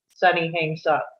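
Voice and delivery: normal talking voice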